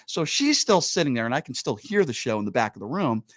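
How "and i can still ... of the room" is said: ordinary delivery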